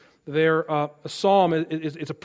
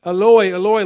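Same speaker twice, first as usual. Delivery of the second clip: cried out, louder; loud cry; 8 dB louder than usual. A narrator's reading. Jesus on the cross, a loud cry. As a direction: call it out in anguish. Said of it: "Jesus cried out in a loud voice".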